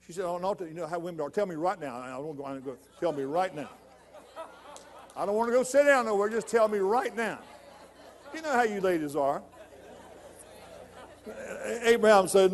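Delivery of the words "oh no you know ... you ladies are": a wife who won't wait to hear; impatient wife. Acted. Wife pushes husband to tell her now, laughing. Sarah demands news, laughing.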